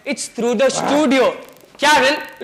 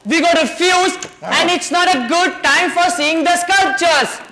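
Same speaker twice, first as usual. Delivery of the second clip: higher, slowing, projected for a deaf listener; speaking up; 4 semitones higher than usual. Acted. Host explaining the fuse to a supposedly deaf guest, speaking up. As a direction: say it high and deliberately, so she can hear. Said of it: "you'll have to speak up".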